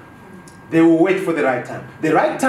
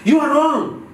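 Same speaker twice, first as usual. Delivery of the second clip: higher, accusing jab at the listener; aggressive retort; 7 semitones higher, slower than usual. Shown as a demonstration. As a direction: snap at a colleague in a meeting. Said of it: "they will say things like".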